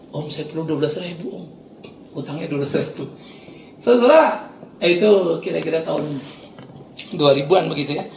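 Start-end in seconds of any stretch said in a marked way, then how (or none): 0.1-3.1 low, very soft admission; sheepish confession. Retold or imitated. Thug admitting his debt to the pastor, retold sheepishly. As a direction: own up in a small, subdued voice.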